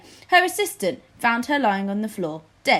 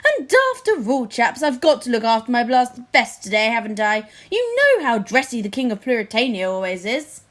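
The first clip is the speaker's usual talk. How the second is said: fussy vain king; 5 dB louder than usual. Acted. Vain King Fred fusses.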